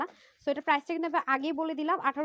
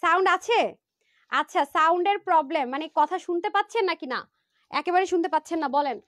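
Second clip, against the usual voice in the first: raised, louder voice so the viewers can hear; calling out loudly; 4 semitones higher, 8 dB louder than usual. Real, not acted. Seller shouting to live viewers who can't hear her, loud and high. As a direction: shout it out over a bad mic. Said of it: "একটু চিল্লাই চিল্লাই কথা বলে".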